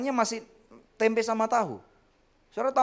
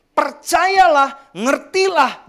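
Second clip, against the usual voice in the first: drawn-out exhortation; 7 semitones higher, 8 dB louder, much slower than usual. Acted. Preacher urges belief.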